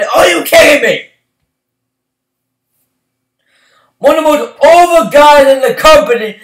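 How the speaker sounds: loud, high, exasperated ranting at the booking; angry rant. Real, not acted. Fan yelling at WWE over Ambrose being left off the card, angry. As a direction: shout it out, fed up and incredulous.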